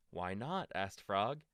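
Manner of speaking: gentle storybook read-aloud; reading to children. A narrator's reading. Parent reading a bedtime story to a child, gentle.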